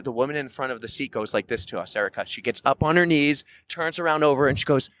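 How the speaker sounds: normal talking voice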